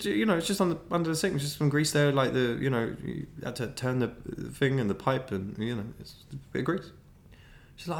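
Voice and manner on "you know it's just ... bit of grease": high, fast nervous rambling; flustered boyfriend inventing a story; babbling excuse. Retold or imitated. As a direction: talk fast so she stops asking. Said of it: "I was like".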